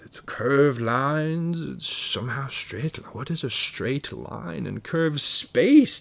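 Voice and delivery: ordinary delivery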